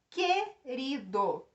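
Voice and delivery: que-ri-do said slowly, syllable by syllable; split into syllables. Shown as a demonstration. Teacher showing students a digraph, slow syllables. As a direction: stretch Querido into clear separate syllables. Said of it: "Vejam só a pronúncia".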